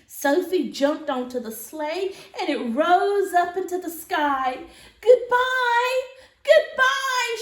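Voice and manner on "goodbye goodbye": high loud farewell call; calling goodbye. Acted. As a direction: call out into the sky. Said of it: "she called".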